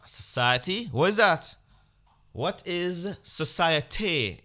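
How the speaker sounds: high, loud and slow scoffing; a reveller sneering, drawn out; mock festival reveller. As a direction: scoff at the very idea of rules. Festivalgoer mocking rules.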